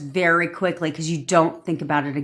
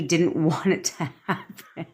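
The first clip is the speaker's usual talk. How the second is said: words breaking into a laugh; 5 dB quieter than usual. Real, not acted. Speaker tells how brother broke the windshield, laughing. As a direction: finish the line as laughter takes over.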